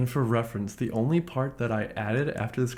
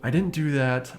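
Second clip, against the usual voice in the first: ordinary talk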